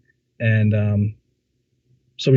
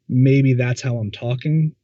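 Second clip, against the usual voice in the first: ordinary delivery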